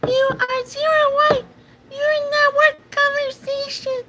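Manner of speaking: put-on very high squeaky baby voice; tiny whining falsetto squeal; mock baby squeak, pitched far up; best Goku. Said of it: "Take your little baby voice".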